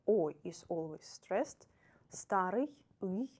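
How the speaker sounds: normal talking voice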